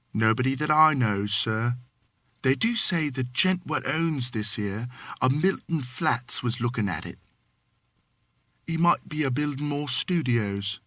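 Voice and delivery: lightly voiced Cockney manservant, deferential; a hint of London Cockney, polite to his master; Cockney servant. Thomas answering his employer, light Cockney.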